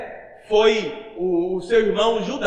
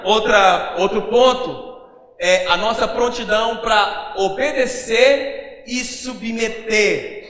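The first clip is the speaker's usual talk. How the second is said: ordinary delivery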